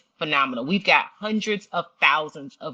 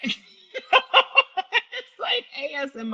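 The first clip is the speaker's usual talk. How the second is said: laughing; 9 semitones higher, much slower than usual. Real, not acted. Laughing mid-sentence.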